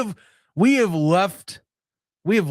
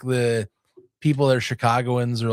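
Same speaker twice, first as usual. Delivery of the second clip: ordinary delivery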